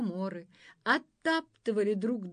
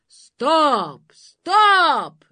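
loud, higher shout of stop; 8 semitones higher, 15 dB louder than usual. Acted. Monster halting the dance, loud shout. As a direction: bellow stop. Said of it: "закричало чудовище".

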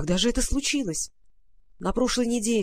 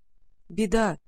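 plain reading voice

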